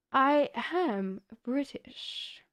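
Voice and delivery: slow mock British I am British; drawn-out teasing British impression; posh British accent. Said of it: "you always have this I".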